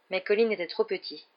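ordinary delivery, nothing marked

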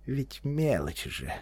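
slow and hushed, as if coaxing yourself; soft, drawn-out self-persuasion; soft self-soothing. A narrator's reading. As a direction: murmur it gently to yourself. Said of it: "мягко сказал он, словно заговаривал сам себя".

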